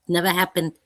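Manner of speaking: ordinary talk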